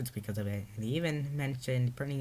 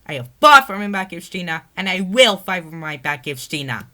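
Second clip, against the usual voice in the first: higher, loud furious declaration; angry shout; 7 semitones higher, 16 dB louder than usual. Acted. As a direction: defy her with fury. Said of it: "replied angrily".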